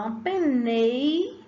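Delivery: slow "uma penei", rising on nei; stretched penei. Shown as a demonstration. Teacher shows pupils how they say "penei". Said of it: "olha como você fala".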